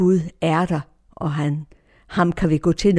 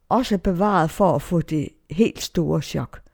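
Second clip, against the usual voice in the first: ordinary delivery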